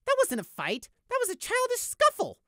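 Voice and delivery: very high, loud scornful tirade; contemptuous rant. Acted. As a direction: berate the guard with scorn. Prey rants at guard.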